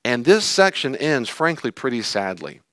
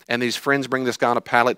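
normal talking voice

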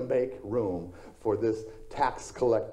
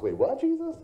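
very high squeak of disbelief; shocked crowd. Retold or imitated. Crowd reacts to Jesus inviting himself, squeaking in shock. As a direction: gasp it out like the crowd can't believe its ears. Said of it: "And the crowd responds".

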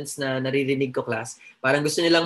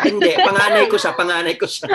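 joke voice; 4 semitones higher, 7 dB louder than usual. Pretend. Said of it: "punchline joke answer".

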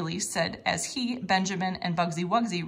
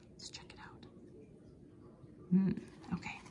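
soft whisper to the listener; hushed aside; 11 dB quieter than usual. Acted. Reader whispers the clue question to children, hushed.

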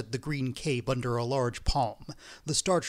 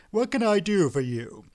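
usual speaking voice

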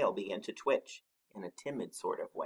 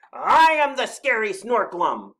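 high, loud monster roar; roared boast; 12 semitones higher, 14 dB louder than usual. A narrator's reading. Snorklum threatening Badger, roaring. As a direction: bellow your name to terrify. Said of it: "he roared".

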